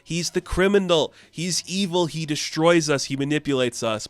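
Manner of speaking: mock angry ranting. The Chained ranting at Clark mid-fight, mock angry. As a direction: hurl the accusations at him like a villain.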